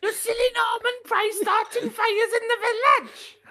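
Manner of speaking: squealing, very high fake Welsh accent; hammed-up falsetto Welsh outburst; Norman Price. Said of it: "worst accent you've ever done".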